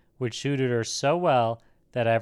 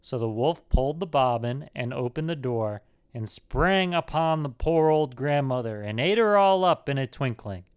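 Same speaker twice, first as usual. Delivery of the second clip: usual speaking voice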